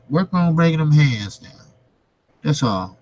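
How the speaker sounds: normal talking voice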